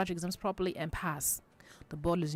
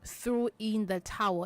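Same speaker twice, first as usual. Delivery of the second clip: slow idiom; 2 semitones higher, slower than usual. Shown as a demonstration. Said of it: "when you hear".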